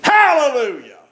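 high, loud preacher's holler; praise shout. Acted. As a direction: belt out praise to the congregation. Preacher shouts praise after the story.

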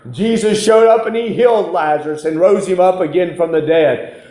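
ordinary delivery

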